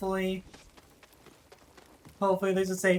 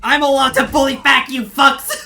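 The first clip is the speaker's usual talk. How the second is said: laughing yell; 5 semitones higher, 10 dB louder than usual. Real, not acted. Repeats it, yelling and laughing.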